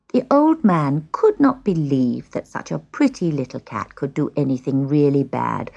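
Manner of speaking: usual speaking voice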